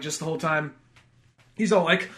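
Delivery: normal talking voice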